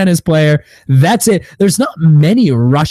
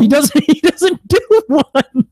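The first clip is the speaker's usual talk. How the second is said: high, slow, laughing protest; laughing disbelief; 12 semitones higher, slower than usual. Real, not acted. Host rebutting praise of an accent, laughing disbelief. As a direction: protest through real laughter, pitch way up.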